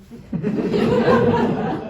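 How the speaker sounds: breathless high laugh; wheezing laughter. Real, not acted. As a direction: let a big laugh out. Laugh alone on a line.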